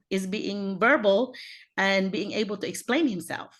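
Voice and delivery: usual speaking voice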